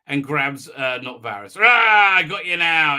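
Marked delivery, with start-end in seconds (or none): 1.6-3.0 loud roar easing into a gloat; mock monster. Reviewer playing the thing that grabs her, mock monster attack. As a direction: pounce with a hammed-up roar. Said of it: "This thing appears out of nowhere".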